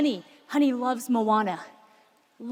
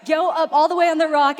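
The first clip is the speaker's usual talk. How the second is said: ordinary talk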